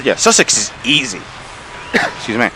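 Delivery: ordinary talk, nothing marked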